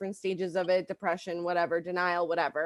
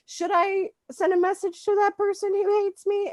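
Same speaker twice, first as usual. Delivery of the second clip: manic inner voice; 11 semitones higher, 3 dB louder than usual. Retold or imitated. Voicing her manic inner thoughts.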